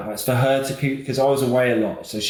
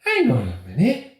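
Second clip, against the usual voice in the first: lightly voiced chatty midwife, pitched up; a hint of an excited midwife recognising him; imitating the midwife; 11 semitones higher than usual. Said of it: "she goes".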